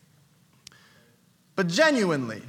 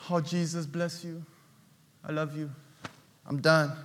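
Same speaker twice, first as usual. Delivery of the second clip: low, soft, dismissive sign-off; much lower, soft, half-hearted; bored lukewarm prayer; 11 semitones lower, 11 dB quieter than usual. Retold or imitated. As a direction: mumble a prayer just to be done. Lukewarm believer's tired prayer.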